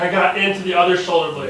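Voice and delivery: excited realization. Retold or imitated. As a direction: say it with a thrilled, rising voice. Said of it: "I'm like".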